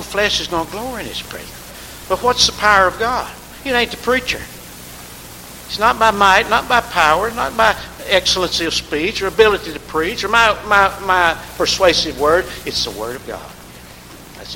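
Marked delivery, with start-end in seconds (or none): 2.1-12.5 higher, louder, building sermon cadence; fervent preaching. Acted. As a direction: hammer each not by home. Preaching at full force.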